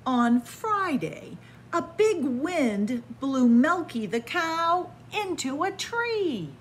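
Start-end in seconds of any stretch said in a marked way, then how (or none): none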